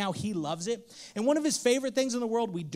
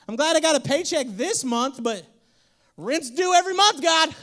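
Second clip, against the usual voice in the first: mock complaint; 5 semitones higher, 8 dB louder than usual. Complaining to God.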